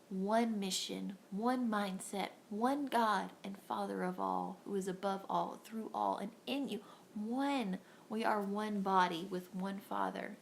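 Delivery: ordinary talk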